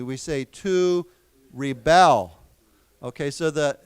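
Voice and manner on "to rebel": "rebel" slowly split, with a loud BEL; stress on second syllable. Shown as a demonstration. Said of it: "we pronounce it differently".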